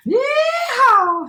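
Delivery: very high, loud yeehaw whoop; cowboy shout. A narrator's reading. Gingerbread cowboy escapes the rancher, high whooping shout. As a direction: let out a high rodeo whoop. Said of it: "he shouted".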